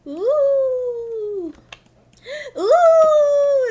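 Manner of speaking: long, high "ooh" whoop; excited whoop. Real, not acted. Streamer before next scene, excited high hooting. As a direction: hoot high and loud, holding the note.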